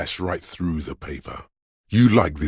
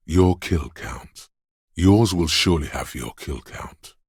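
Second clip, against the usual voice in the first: ordinary talk, nothing marked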